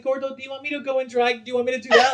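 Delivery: high, eager mother voice; mock motherly pleading; imitated mom. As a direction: pitch it up like an over-anxious mom offering. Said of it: "She's like".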